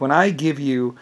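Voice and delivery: ordinary talk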